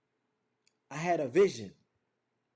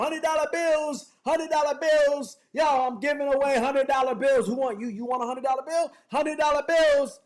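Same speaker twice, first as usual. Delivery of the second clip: street hawker yelling; 8 semitones higher, 8 dB louder than usual. Retold or imitated. In Manhattan, yelling to passersby, loud hawker call. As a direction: call it out to the whole street like a vendor. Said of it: "yelling out".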